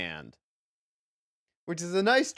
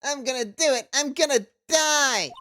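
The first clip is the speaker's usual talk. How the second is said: high, melodramatic whine; whiny client voice; 9 semitones higher than usual. Acted. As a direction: wail it high like a hopeless wimp. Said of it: "That's my whiny voice".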